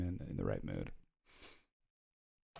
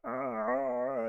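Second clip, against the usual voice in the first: drawn-out throaty uh; strangled vowel held from the throat; throaty Kermit voice; 3 semitones lower, 5 dB quieter than usual. Shown as a demonstration. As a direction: close off the back of the throat. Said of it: "thing at the back of your throat".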